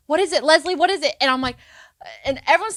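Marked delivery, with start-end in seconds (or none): 0.1-1.1 a hint of a pushy teacher, higher and louder; impatient teacher. Teacher demanding the answer from the student, mock pushy. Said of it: "And then she's like".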